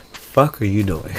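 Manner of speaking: low, soft aside; quiet retold grumble; muttered disbelief. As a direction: mutter it under your breath.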